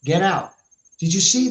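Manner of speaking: ordinary talk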